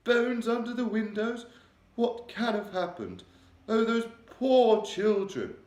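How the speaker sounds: high, loud, regal voice, shaken; a distressed queen confiding in her maid. A narrator's reading. The Queen telling Mary of her nightmare, regal and upset.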